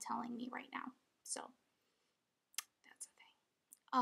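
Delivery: soft unvoiced whisper. Real, not acted. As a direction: drop into a hushed whisper. Whispering about sensor failure.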